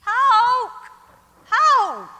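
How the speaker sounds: loud, urgent calls for Hoke; calling out. Acted. Old lady shouting for her driver, loud calling. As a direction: shout his name across the house.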